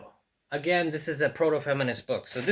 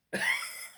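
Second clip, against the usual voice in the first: quick high giggle of surprise; a short high burst of laughter; delighted laugh; 4 dB quieter than usual. Real, not acted. Collector laughing as the book is unwrapped, high giggle.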